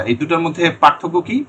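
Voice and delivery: normal talking voice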